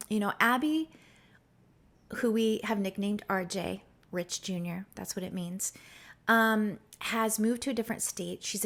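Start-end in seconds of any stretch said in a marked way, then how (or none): none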